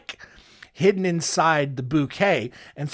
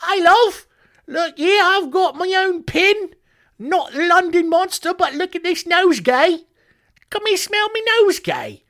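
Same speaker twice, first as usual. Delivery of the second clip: very high, loud mock Cockney; awful high-pitched Cockney; 5 dB louder than usual. The London Monster luring victims to sniff his bouquet, hammed-up high Cockney. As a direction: squeal it out as a hammed-up Cockney villain. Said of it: "high-pitched Cockney accent".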